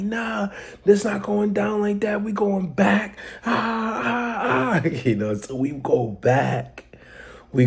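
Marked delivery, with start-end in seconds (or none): 0.0-3.2 higher, pumped-up call; rally the crew to go back; fired-up rally. Retold or imitated. Rallying friends for revenge, fired-up higher call. 3.5-4.9 hype yelps. Real, not acted. Excited hype noise.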